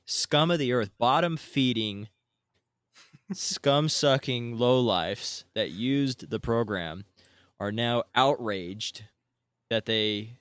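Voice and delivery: angry rant. Real, not acted. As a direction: spit each insult out in real outrage. Rant continues building.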